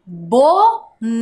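"Boné" in two separated, drawn-out syllables. Shown as a demonstration. Teacher revealing a new word to pupils, "Boné" syllable by syllable. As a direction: sound out "Boné", pausing between bo and né. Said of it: "ficou o quê?".

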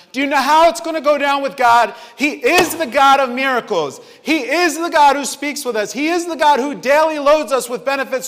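loud, high fervent preaching. Acted. Preacher rallying the church about God, fervent. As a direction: preach it hard to the congregation.

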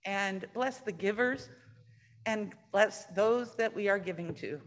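soft, hushed prayer voice. Acted. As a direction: bless the gifts gently. Leads offering prayer softly.

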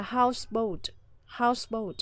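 houseboat with HOUSE stressed, boat falling; stress on first syllable. Shown as a demonstration. Teacher models compound houseboat, stress on house. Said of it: "trọng âm sẽ rơi vào âm tiết đầu tiên".

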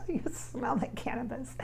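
secretive, giggly whisper. Real, not acted. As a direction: lean in and whisper it like a naughty secret. Repeats the line as a secret.

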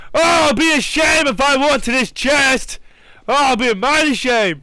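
high, loud mock lament; mock sing-song. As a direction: ham up a fake pity. Mock remark about chest.

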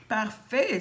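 usual speaking voice

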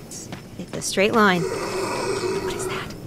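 slow, tense whisper; scared whisper. Real, not acted. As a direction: breathe it out, frozen in fear. Hears something in horror game.

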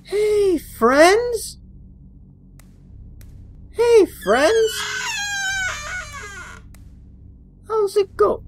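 high calling voice; high cheery greeting; mock friendly call. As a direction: sing out to the mannequins. Calling to mannequins.

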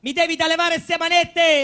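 slow, loud demand; demanding shout. Acted. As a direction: insist loudly, slowly. Thief demands handcuffs off. (grida lento e forte)

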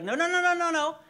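high, urgent repeated no. Retold or imitated. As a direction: stop the sheep in alarm. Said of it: "the shepherd will be like".

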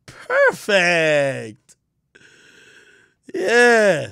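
normal talking voice

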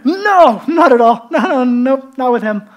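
loud, high protest easing off; emphatic denial. Acted. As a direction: deny it hard, first word highest. Peter loudly denies knowing Jesus.

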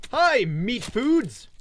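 high, loud, slow glee; gleeful shout. Real, not acted. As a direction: greet the food with hungry delight. Greeting prey gleefully.

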